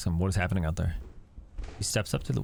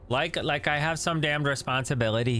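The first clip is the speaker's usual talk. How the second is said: scoffing; 6 semitones higher than usual. Acted. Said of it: "he scoffs".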